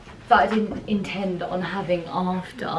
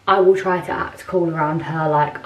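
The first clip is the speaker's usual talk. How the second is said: usual speaking voice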